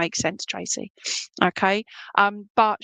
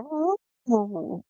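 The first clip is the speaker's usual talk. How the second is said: dog howling; slower than usual. Retold or imitated. Medium imitating Bluey the dog's howl. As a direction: howl like the dog, then let it die off.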